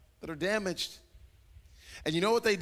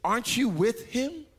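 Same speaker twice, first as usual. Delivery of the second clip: put-on higher, questioning little girl voice; a hint of a child calling him out; little girl accusing; 6 semitones higher than usual. Girl in the courtyard questioning Peter, higher and pointed. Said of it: "Another little girl".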